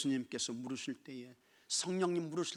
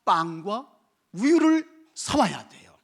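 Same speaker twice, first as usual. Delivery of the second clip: slow, deliberate; 6 semitones higher, 12 dB louder, slower than usual. Shown as a demonstration. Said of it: "뭐 해야 됩니까?".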